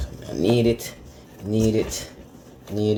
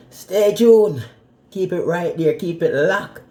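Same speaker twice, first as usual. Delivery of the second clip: hype host sign-off; 8 semitones higher, 4 dB louder than usual. Acted. Host calls out to viewers.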